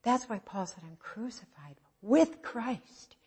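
ordinary talk, nothing marked

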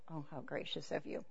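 soft, unimpressed mock thanks; dry sarcasm. Wife answering her husband's grand forgiveness, dry sarcasm. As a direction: mutter it low and sarcastic.

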